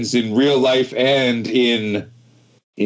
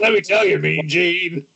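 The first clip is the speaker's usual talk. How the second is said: high mock promo call; wrestling promo; 5 semitones higher than usual. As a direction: hype it like an old interview. Mimics promo opener.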